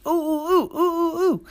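high, delighted little squeals; excited squeals. Acted. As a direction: squeal with joy. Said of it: "the sisters were so happy".

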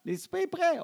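high-pitched mimicked dinner call. Mother repeats supper call to silent kids, high mock holler. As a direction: call kids from afar, in imitation. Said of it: "Elle crie".

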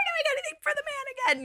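hammed-up high vow never to work for the man; mock defiant vow. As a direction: squeak it like a defiant cartoon. Vowing to quit corporate life.

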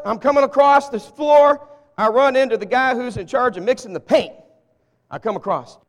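loud, breezy boss in passing; busy boss. Shown as a demonstration. Boss crossing the floor greeting the paint mixer, loud and breezy. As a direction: play a hurried boss tossing off a greeting. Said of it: "I'm a boss at work".

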